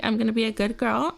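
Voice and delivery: normal talking voice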